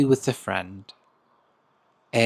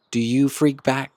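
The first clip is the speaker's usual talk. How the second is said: ordinary delivery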